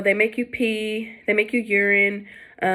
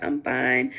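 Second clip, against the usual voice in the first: flat low reply; 7 semitones lower than usual. Retold or imitated. Answering nurse while nervous.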